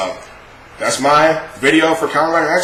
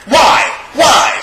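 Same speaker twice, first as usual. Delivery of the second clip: exasperated why; 11 semitones higher than usual. Real, not acted. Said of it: "exasperated rant".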